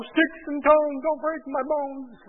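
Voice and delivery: put-on high, slow sing-song child's chant; playground taunt. Kids in the park taunting each other, in a high sing-song chant. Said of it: "kids in the park would say".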